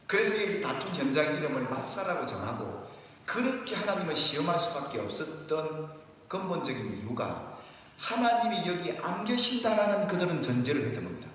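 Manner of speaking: ordinary talk, nothing marked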